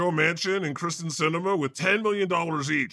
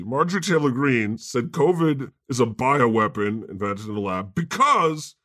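normal talking voice

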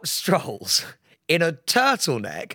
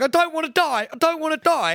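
high-pitched mock panic, imitating a terrified agent; panicked wail; 10 semitones higher, faster than usual. Imitating the terrified agent running round during the fire alarm, high panicked wail. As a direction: wail it like she thinks she's about to die. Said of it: "running round going".

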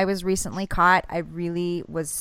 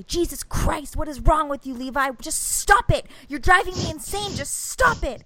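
put-on higher, exasperated yell at a kid; loud exasperated scolding; 7 semitones higher than usual. Mom losing her temper at her son in a coffee shop. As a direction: snap at your son, losing it in public. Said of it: "I said so loud in front of everybody".

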